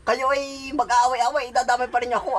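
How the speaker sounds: a hint of an annoyed neighbor telling off the couple; mock scolding neighbor.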